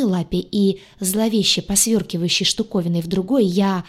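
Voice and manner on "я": usual speaking voice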